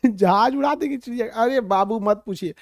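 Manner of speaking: normal talking voice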